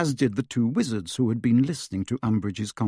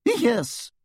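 tiny high squeak; squeaky yes; 13 semitones higher than usual. A narrator's reading. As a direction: squeak it out nervously. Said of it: "squeaked Hermione".

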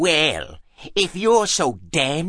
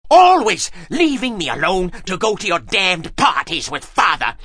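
high-pitched accusing outburst; acted raging complaint; enraged; 8 semitones higher than usual. A narrator's reading. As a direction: rail at your mother in a tantrum. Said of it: "threw him into a rage".

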